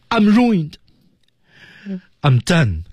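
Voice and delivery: high, theatrical collapse into ruin; acted despair. Acting out turning round and kneeling, theatrical despair. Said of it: "明显是一种desperate的感觉".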